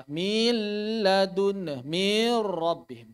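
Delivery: "Milladun" stretched very slowly, every syllable drawn out; wrong lengthening. Shown as a demonstration. Teacher shows a wrong drawn-out reading, very slow. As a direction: drag "Milladun, mir rabbihim" far too long. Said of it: "Atau memanjangkan suara".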